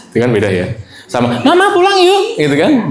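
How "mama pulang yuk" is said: put-on very high, pleading kid's voice; little child voice. Shown as a demonstration. Teacher imitating a small child asking mama to go home, high. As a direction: squeak it up like a small child begging. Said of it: "Beda kan?".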